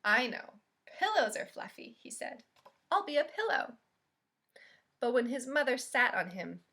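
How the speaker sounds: gentle, expressive storybook reading; picture-book read-aloud voice; reading to children. Acted. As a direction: read it like storytime for little kids.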